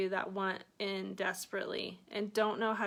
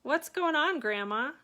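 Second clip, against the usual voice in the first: teasing Southern twang, higher and louder, with a laugh; witty twang; 4 semitones higher, 3 dB louder than usual. Retold or imitated. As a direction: poke fun at grandma in a twang. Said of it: "I laugh and say in a witty twang".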